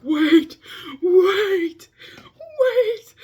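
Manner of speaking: shrieking high panicked "wait"; sudden scared shriek of wait. Retold or imitated. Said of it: "I'm like".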